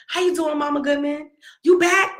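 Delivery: bright greeting from his crew; mimicking the boys' high cheerful hello; high friendly teen boys. Son's friends greeting her.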